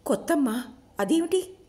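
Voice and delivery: high-pitched, startled question; puzzled surprise. A narrator's reading. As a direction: ask it high, taken aback. Listener puzzled at new mother.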